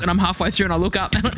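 ordinary talk

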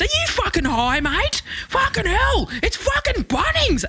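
high, hammed-up incredulous yell; mock outraged bloke. Retelling the car park bloke, high and outraged. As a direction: squeal it like an outraged old man. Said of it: "the bloke ... goes".

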